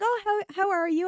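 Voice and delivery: high, flustered repeat of the greeting, caught off guard; imitating a thrown-off neighbour, high and hesitant; mock startled local. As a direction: stammer it back in surprise, pitched well up. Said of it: "They'd be like".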